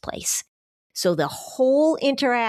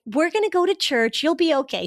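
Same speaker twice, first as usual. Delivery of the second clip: put-on sweet kid; 7 semitones higher than usual. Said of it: "They're like".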